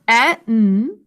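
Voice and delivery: "e, n" as two isolated letter sounds; "e, n" sounded one at a time; separate sounds. Shown as a demonstration. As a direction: say "e, n" as short eh, then held nnn. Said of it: "two sounds".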